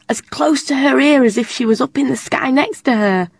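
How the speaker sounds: ordinary delivery, nothing marked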